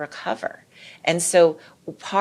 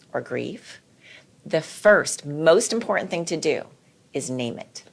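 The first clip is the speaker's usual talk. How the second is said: ordinary delivery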